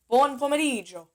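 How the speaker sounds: Buon pomeriggio with gio said as one sound like Joe; correct pronunciation. Shown as a demonstration. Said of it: "Almost like you're saying Joe".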